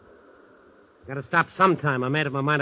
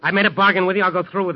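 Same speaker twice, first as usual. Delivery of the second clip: angry outburst; 5 semitones higher, 9 dB louder, faster than usual. Acted. Angry quarrel with his uncle.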